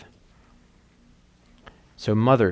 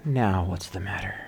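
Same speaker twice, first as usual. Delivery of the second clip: weary sigh; 3 semitones lower, 6 dB quieter than usual. Acted. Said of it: "which made her sigh".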